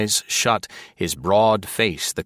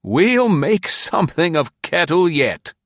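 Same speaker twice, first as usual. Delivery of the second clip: high, loud, delighted man's voice; 10 semitones higher, 5 dB louder than usual. A narrator's reading. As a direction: gloat with delight. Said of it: "chuckled delightedly".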